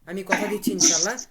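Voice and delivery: ordinary talk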